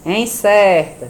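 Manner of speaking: "Encerta" slow and loud, CER held; read aloud, syllables stressed. Shown as a demonstration. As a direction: lean long on CER in "Encerta". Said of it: "Lê em voz alta as palavras".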